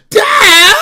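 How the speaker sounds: high shrieking crying yell; whining wail. Retold or imitated. Daughter throwing a tantrum at dad, high wailing shout. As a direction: wail his name like a tantrum. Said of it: "she be like".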